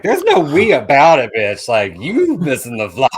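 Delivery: laughing mock shout; mock yelling back. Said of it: "I was like".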